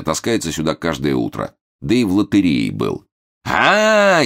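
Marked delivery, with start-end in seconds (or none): 3.4-4.2 high, loud, drawn-out "aah"; predatory drawl. A narrator's reading. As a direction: pounce with a long gloating cry. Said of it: "хищно протянул Момус". (хищно тянет, высоко и громко)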